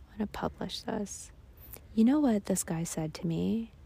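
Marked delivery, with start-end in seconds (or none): 0.1-1.3 quiet. Real, not acted. Repeated much softer.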